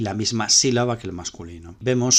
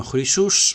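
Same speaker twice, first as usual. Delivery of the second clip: "chrysus" higher and louder, stressed on its last syllable; perispomenon accent; 4 semitones higher, 6 dB louder than usual. Shown as a demonstration. Teacher giving a masculine example, "chrysus" with strong final stress. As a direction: lift and punch the end of "chrysus". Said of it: "acento perispómeno".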